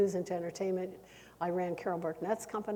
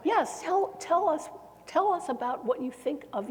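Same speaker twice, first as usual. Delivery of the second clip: higher-pitched retold urging, easing down; eager prompting; 8 semitones higher than usual. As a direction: press for an answer with a raised voice.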